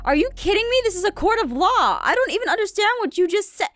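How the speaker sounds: high incredulous outburst; exasperated disbelief, pitched high; outraged. Acted. Lawyer outraged at ruling.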